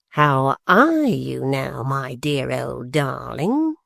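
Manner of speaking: very slow, mock tender; great affection. A narrator's reading. Quilp to his mother-in-law, slow mock affection. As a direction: smother her with false affection. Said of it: "in a tone of great affection".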